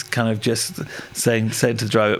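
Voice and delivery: ordinary talk, nothing marked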